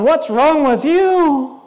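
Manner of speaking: slow, drawn-out mock accusation; acted incredulous reproach; accusing. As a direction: stretch it out, judging the other person. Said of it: "you're looking at me going".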